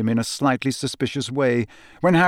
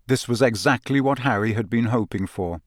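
usual speaking voice

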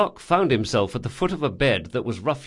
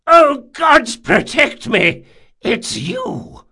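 very high, loud, drawn-out wail of alarm; terrified cry; 10 dB louder, slower than usual. A narrator's reading. Old man recognises the man he fears, terrified cry. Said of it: "he cried".

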